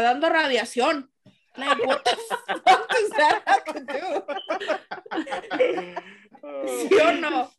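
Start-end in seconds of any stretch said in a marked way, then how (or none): 1.6-7.5 laughing. Real, not acted. Laughing at mom's logic.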